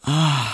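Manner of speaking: high, voiced sigh; blissful sigh. A narrator's reading. Suitor sighs as he presses her close, blissful. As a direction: let out a loud, lovestruck "ah". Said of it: "seufzte Emil Kubinke".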